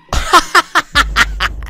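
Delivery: loud high cackling burst; real laughter. Real, not acted. Player caught out by a goat scream, loud high laughter.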